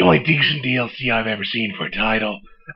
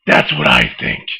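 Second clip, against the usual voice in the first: deep gravelly Batman growl; low, growling caped crusader; 13 semitones lower than usual. Acted. Holds the Batman voice.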